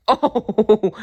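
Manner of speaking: high ticklish giggle; a bear squealing with giggles; giggling. A narrator's reading. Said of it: "Friend Bear giggled".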